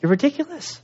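high, scornful brush-off; mock contempt; dismissive. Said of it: "We could have just said".

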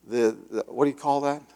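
ordinary delivery

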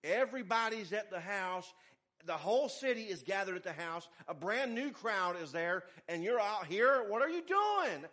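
high, loud, agitated disciple calling after Jesus; alarmed Peter hurrying Jesus back. Acted. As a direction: scold him like a worried friend. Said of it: "That's what Peter's saying".

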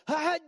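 high loud sudden yelp; mock snapping outburst. Pastor imitating his own outburst, high and loud. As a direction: burst out like losing patience. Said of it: "I go".

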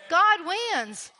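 ordinary talk

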